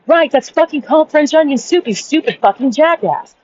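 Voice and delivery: high-pitched, fed-up insistence; exasperated retold correction. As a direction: pitch rising in mock outrage at his stubbornness. Retold argument escalates.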